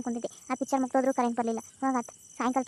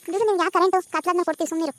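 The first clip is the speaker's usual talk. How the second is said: sharp, louder and higher brush-off; busy worker snapping at a nagging old woman; irritated lineman; 5 semitones higher, 5 dB louder than usual. Acted. Lineman snaps at grandma.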